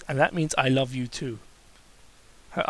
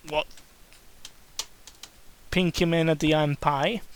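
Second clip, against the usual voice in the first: usual speaking voice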